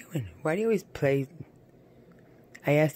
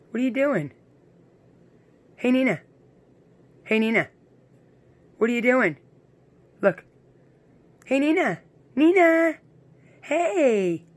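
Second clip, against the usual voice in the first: high, loud pet call, climbing higher; excited calling; 8 semitones higher, 7 dB louder than usual. Real, not acted. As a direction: call the dog eagerly, louder each time.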